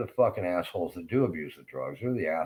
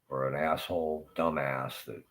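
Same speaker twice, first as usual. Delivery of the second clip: ordinary delivery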